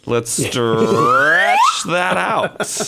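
long drawn-out STRETCH, swooping high; slow, soaring, hammed-up delivery; drawn-out campy call. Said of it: "Do them one more time".

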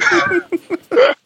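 squealing laugh; high laugh. Real, not acted. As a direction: burst of shrill laughter. Laughing after the rant.

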